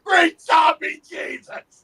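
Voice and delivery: slow booming battle-cry yell; full shout. Acted. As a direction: bellow it like the Cretan in the bar. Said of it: "I yelled".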